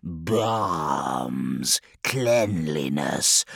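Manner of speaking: low, slow hiss of disgust; disgusted demon, low and dragging. A narrator's reading. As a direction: draw it out with slow loathing. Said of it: "he hissed".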